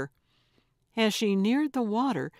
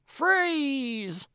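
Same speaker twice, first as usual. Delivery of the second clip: shouted command; 7 semitones higher than usual. A narrator's reading. Old woman screaming at fleeing fraud to stop. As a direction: bark it as she chases.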